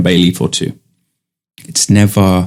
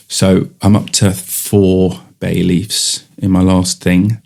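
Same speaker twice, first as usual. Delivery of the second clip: usual speaking voice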